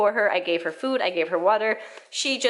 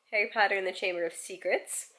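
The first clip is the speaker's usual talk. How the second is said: usual speaking voice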